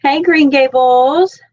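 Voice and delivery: loud, slow, drawn-out hello; excited welcome. Real, not acted. Welcoming Green Gables Homestead to the live stream, excited. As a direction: call out a happy hello to the newcomer.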